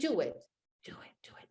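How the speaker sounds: urgent unvoiced whisper; a small inner voice whispering on. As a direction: hiss it like a voice urging you on inside. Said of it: "Just, just a small voice".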